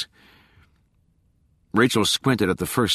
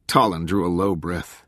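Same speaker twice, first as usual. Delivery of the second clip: ordinary delivery, nothing marked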